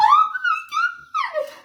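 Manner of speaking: very high, slow squeal of joy; stunned happy squeal; overjoyed gasp. Retold or imitated. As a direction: breathe it out in disbelief. Hearing she can move in.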